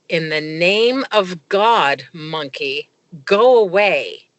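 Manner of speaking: slow, firm command; solemn order. Retold or imitated. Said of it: "I said".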